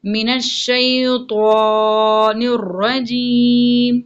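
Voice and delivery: full slow tajweed recitation of the taawwuz; slow Quranic recitation. Shown as a demonstration. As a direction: recite the whole line slowly, melodiously. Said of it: "موٹا کر کے پڑھیں گے". (تجوید کے ساتھ آہستہ تلاوت)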